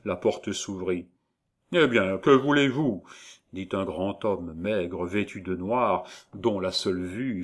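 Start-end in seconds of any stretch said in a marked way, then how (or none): 1.7-3.0 loud, raised, brusque question; acted imposing official; stern official. A narrator's reading. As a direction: demand the visitors' business. Stern official opens the door.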